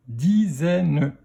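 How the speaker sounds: "dizaine" split into di, zè, ne; "dizaine" in three separate beats; syllable by syllable. Shown as a demonstration. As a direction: sound out "dizaine" with a final ne. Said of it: "dans quelle syllabe".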